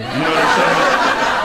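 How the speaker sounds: slow, loud, laughing through it; laughing aside. Real, not acted. Comic asking the crowd, loud and laughing. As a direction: push it out between laughs.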